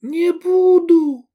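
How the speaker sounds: very high, loud, drawn-out refusal; high girlish yell, stretched out; girl's defiant cry. A narrator's reading. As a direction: shout back in defiance. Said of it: "крикнула девушка".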